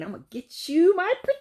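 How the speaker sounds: high cackling witch threat, my pretty up high. Pretend. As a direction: play the Wicked Witch gloating. Witch quote, laughs after.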